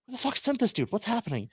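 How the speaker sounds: high-pitched mock bewilderment; baffled disbelief. Wrestler eyeing an unknown runner, high baffled disbelief. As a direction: voice a baffled stare, pitched well up. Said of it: "he's just looking at me like".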